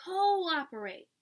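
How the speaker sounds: Cooperate repeated slowly and firmly; correcting emphasis. Shown as a demonstration. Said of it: "Did someone say operate?".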